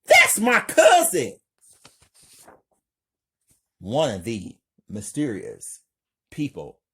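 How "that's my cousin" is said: horrified high scream; very high, loud distressed yell. A narrator's reading. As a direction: scream out loudly in shock. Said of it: "Megan yells".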